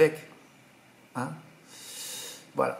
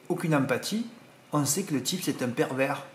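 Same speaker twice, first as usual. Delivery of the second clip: ordinary talk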